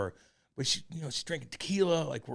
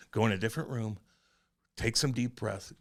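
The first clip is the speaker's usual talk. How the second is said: ordinary talk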